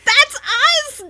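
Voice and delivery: high, loud thrilled yell; excited shout. Real, not acted. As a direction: shout it out, overjoyed. Thrilled at being named.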